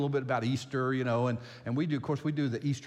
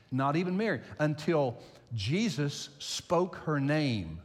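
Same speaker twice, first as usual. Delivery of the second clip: ordinary delivery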